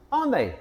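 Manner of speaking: "aren't they" pitched high, then dropping; question tag intonation. Shown as a demonstration. Teacher showing question tags, high-pitched "aren't they". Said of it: "our voice goes up a bit".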